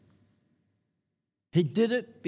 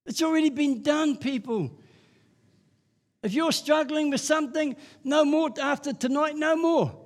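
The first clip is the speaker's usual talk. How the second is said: high, fervent preacher's cry; rising preaching; 7 semitones higher than usual. Acted. Preacher urging the congregation, high and fervent. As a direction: lift it up to the crowd.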